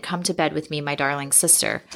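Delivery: ordinary delivery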